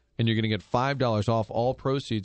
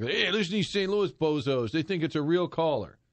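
higher-pitched mock announcer jeer; mocking radio host; 4 semitones higher than usual. Host imagining rival radio crew laughing at them, mocking voice. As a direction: crow it like a gleeful rival host.

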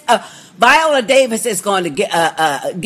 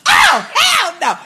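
shocked outcry; 13 semitones higher, slower than usual. Retold or imitated. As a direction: shout it in disbelief, drawn out. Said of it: "I said".

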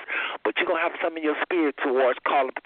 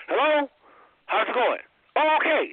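bright, much higher, put-on cheer hiding sadness; fake cheerful; 9 semitones higher than usual. Shown as a demonstration. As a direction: sound upbeat on the phone while depressed. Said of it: "how I'm gonna sound?".

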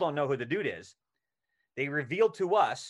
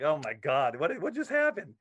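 stunned disbelief; 2 semitones higher than usual. Retold or imitated. Retelling his stunned reaction on set.

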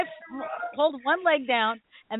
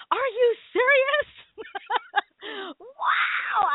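very high-pitched amazed squeal; acted-out delighted disbelief, breaking into laughter; stoked; 13 semitones higher, much slower than usual. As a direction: relive the thrill in a high, giddy voice. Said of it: "I was so stoked".

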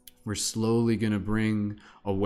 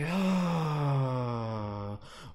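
big sigh; 5 semitones higher than usual. Real, not acted. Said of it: "nice big sigh".

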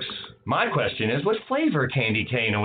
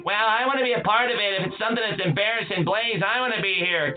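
high nasal-free sidekick voice, pitched up; Radio Rex voice; 8 semitones higher, faster than usual. Acted. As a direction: barge in as a pushy sidekick. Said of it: "it's Radio Rex".

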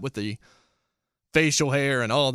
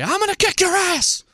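much higher, loud wrestling yell; over-the-top shouted wrestling threat; screaming promo; 5 dB louder than usual. Shown as a demonstration. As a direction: scream it at the top of your lungs like a cartoon heel. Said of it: "instead of screaming at you".

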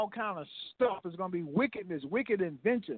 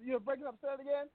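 phone line; 4 semitones higher, 6 dB quieter, much faster than usual. Real, not acted. Remote guest on bad line.

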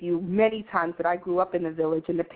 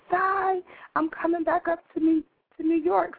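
quoting a friend; 10 semitones higher than usual. Retold or imitated. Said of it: "she said".

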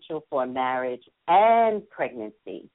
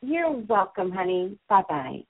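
usual speaking voice

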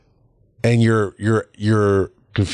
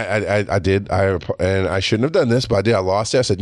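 usual speaking voice

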